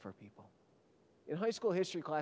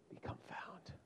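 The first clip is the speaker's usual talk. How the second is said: hushed; 13 dB quieter, slower than usual. Real, not acted. Preacher drops to a hush.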